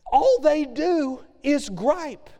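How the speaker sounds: high, slow, scornful drawl; mock gripe. Preacher mocks the Israelites, high and slow. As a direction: sneer at their griping.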